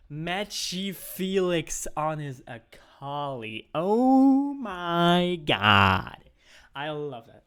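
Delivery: loud, slow, drawn-out caster hype; excited hype. Real, not acted. Caster calling a flashy pick, loud and drawn out. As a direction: stretch the name out like a hyped caster.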